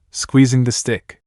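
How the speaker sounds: usual speaking voice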